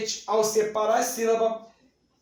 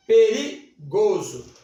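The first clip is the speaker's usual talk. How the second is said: perigoso slow and louder, stretched for effect; dramatic emphasis; 5 dB louder, slower than usual. Shown as a demonstration. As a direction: lean hard on perigoso, slowly. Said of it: "que eu chamo de dígrafo". (ênfase dramática em perigoso)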